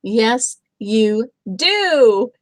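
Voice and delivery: loud playful shout. A narrator's reading. Bear telling friend he needs a bath, loud shout. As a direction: shout it back at him. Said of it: "shouted Big Fluffy Bear".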